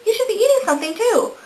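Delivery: high, sing-song voice of a tempting brain. Retold or imitated. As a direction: play her brain egging her on to snack. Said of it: "and my brain's like".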